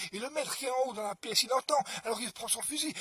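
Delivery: ordinary delivery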